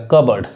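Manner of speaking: कबर्ड said as "kub-erd"; correct pronunciation. Shown as a demonstration. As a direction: say कबर्ड with silent p. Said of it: "पी नहीं पढ़ाएगा".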